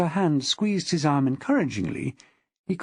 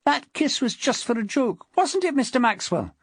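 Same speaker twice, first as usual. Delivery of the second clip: high-pitched, insistent woman's voice; placating wife; 9 semitones higher than usual. A narrator's reading. Wife explaining the kiss to her husband, placating. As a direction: make light of it in a high voice.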